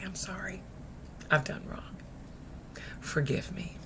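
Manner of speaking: very soft, whispered plea; hushed repentance. Acted. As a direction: confess it barely aloud. Models a repentant prayer.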